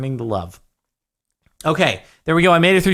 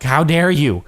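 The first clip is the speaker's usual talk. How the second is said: playful outrage, louder and higher; mock indignation; 4 semitones higher, 5 dB louder than usual. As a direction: scold them in mock offence.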